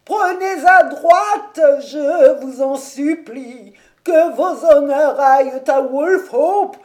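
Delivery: high, loud, slow wailing lament; broken breathless cry. A narrator's reading. Old servant crying out to his master the tower burns, high broken wail. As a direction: cry out in broken gasps of despair. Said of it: "on l'entendit crier d'une voix entrecoupée".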